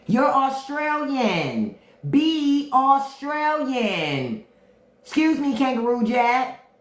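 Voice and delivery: high, slow put-on Australian voice; movie quote voice. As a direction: drawl it like a movie character. Quoting a movie character.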